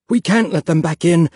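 crying out. A narrator's reading. Father barricading the door, alarmed cry. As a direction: cry it out in panic. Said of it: "Papa cried".

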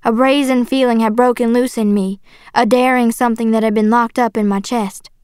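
plain reading voice

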